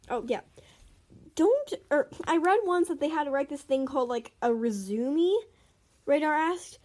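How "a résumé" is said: resume said as "reh-ZOO-mee"; mispronounced word. Acted. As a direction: say "resume" wrongly, ending in a rising "mee". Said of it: "why you say it wrong".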